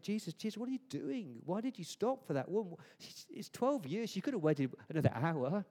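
high-pitched exasperated pleading at Jesus; frustrated Jairus. Retold or imitated. Desperate father complains to Jesus about delay, exasperated. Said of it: "you imagine Jairus is frustrated".

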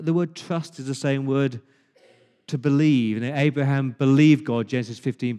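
normal talking voice

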